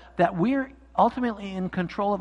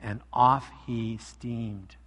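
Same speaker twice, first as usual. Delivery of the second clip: story reading; 3 semitones lower than usual. A narrator's reading. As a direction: read it like story hour for kids. Pastor reads story aloud.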